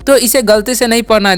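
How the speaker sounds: ordinary delivery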